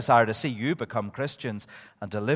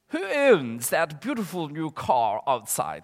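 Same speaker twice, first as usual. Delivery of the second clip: slow, put-on posh Hochul accent; Mister Toad; 2 semitones higher, slower than usual. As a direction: draw it out in the toad's Hochul accent. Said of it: "That's the way Mister Toad talked".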